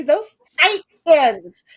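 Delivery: slow and loud, stress on tight; stressed correction. Shown as a demonstration. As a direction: drag out "tight ends" to fix the wording. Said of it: "Get the verbiage right".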